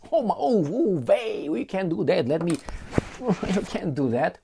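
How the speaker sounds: high, hammed-up alarmed cry; mock horror at the missiles in Cuba; mock panic. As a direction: squeal it like a panicking official. Americans panic at Cuba news.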